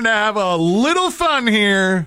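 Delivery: high, slow sneering voice; sarcastic mock apology. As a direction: stretch it out, mocking the excuse. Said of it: "I'm reading it very sarcastically today".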